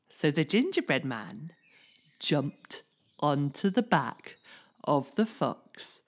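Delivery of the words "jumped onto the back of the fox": ordinary delivery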